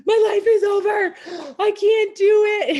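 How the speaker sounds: high wailing cry of a woman who thinks her life is over; imitating her own crying. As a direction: replay the meltdown as a high wail. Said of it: "crying to Artina 'cause I was like".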